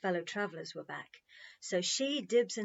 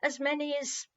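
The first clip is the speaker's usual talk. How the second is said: pressing; 6 semitones higher than usual. A narrator's reading. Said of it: "Peter pressed".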